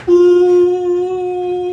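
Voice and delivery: high hooting troll. Acted. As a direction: let out a high troll coo. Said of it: "the troll goes".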